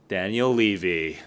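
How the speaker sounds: dry sarcastic name-drop. Real, not acted. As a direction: say it with heavy irony. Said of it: "I hope you feel the sarcasm".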